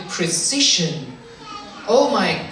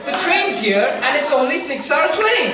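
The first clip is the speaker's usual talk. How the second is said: cheerful gratitude; 5 semitones higher than usual. Retold or imitated. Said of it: "my general reaction is".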